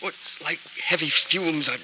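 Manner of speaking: strained, breathless and pained; fighting for breath as the fumes choke him; gasping in distress. Acted. Dying husband to Gail, gasping for breath.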